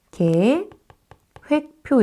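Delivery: 계획표 said slowly with 계 as a clear glide. Shown as a demonstration. Teacher models 계획표 slowly for the class. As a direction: drag out 계획표 carefully. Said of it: "이렇게".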